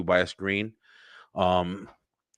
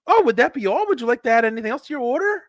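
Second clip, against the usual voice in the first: much higher, fast, sugary upsell voice; happy drive-thru worker; 13 semitones higher, 4 dB louder, faster than usual. Retold or imitated. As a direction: rattle off the upsell in a too-cheerful server voice. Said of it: "it's all happy-ass person on there".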